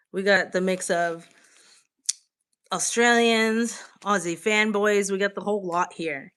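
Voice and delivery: ordinary delivery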